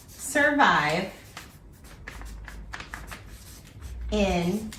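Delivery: word by word with long pauses; slow dictation, a phrase at a time as you write; dictating while writing. Real, not acted.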